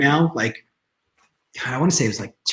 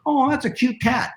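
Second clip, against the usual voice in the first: much higher, adoring group reaction; mock cooing; 9 semitones higher than usual. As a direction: imitate everyone fawning over a pet. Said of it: "everybody goes".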